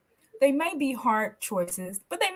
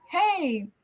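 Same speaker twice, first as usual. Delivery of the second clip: eager dog voice; 6 semitones higher than usual. Retold or imitated. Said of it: "they're like".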